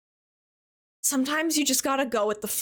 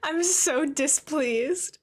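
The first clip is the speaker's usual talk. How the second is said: mock displeased; 3 semitones higher, much slower than usual. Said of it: "the word is displeased".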